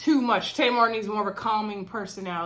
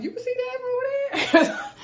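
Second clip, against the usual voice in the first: squeaky mock voice with a laugh, much higher. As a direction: toss it off in a high, laughing put-on voice. Rashawn quoted, laughing, mock voice.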